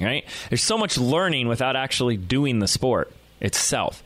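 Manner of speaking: usual speaking voice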